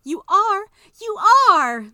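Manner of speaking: very high, very loud squeal of joy; excited cry. Acted. Maybelle squeals with joy hugging her mama. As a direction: squeal with delight. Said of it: "Maybelle cried".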